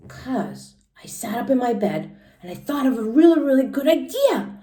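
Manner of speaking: Junie B. read aloud. Acted. Teacher reading Junie B. to the class in a high kid voice. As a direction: read it as an excited first-grader telling her story.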